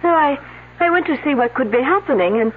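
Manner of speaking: put-on light French accent throughout. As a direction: speak English with a French lilt. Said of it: "unless someone's imitating her accent".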